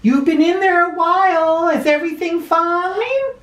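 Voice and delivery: light high-pitched wife calling through the door. Acted. Wife asking her husband in the bath, high voiced. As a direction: voice the First Lady, high and gentle. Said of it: "Yes, Nellie," he said".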